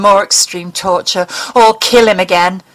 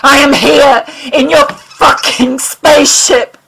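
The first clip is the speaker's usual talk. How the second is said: higher, louder, slowing on fucking spaceship; furious shout; 6 semitones higher, 5 dB louder than usual. Acted. Mulder shouts at the alien where he is, furious. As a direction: hammer each word in rage.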